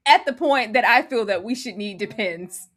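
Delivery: ordinary delivery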